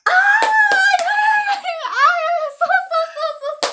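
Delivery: high, loud, delighted yeahs; laughing and cheering her on; excited. Real, not acted. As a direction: squeal with delight at her accent. Delighted by the accent demo.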